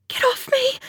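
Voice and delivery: very high, strained little cry; panicked, pitch shooting up; choked, frightened protest. A narrator's reading. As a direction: force it out past a lump in the throat. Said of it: "force a whisper past the lump in my throat".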